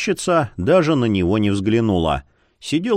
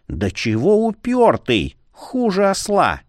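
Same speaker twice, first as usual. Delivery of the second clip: slow, high-rising annoyance; fed-up grumbling cry; exasperated scolding; 7 semitones higher than usual. A narrator's reading. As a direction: scold a stubborn pest, drawing it out. Fed-up clerk scolding him.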